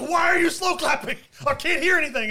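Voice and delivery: high, loud mock outburst; exasperated retold yell. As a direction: yell in hammed-up protest. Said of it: "he's like".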